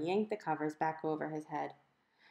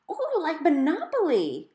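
high, loud, drawn-out delight; excited exclamation; 10 semitones higher, 7 dB louder than usual. A narrator's reading. Violet exclaiming to Mom, high and loud with delight. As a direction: gush it like a thrilled kid. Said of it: "Violet exclaimed".

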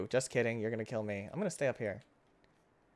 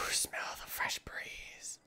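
slow, breathy whisper; dreamy whisper; 7 dB quieter, much slower than usual. Pretend. Player enjoying the game scenery, in a slow dreamy whisper. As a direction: savour the air, slowly. Calm moment after a sigh.

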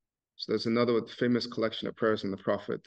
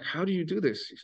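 amazed; 8 semitones higher than usual. Retold or imitated. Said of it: "he was like".

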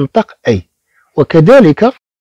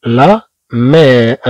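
لا مير syllable by syllable, slow; teacher models long vowel; slowly sounded out; 2 semitones lower, much slower than usual. Shown as a demonstration. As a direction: stretch every sound of لا مير. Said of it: "كما تلاحظوا نفس الشيء".

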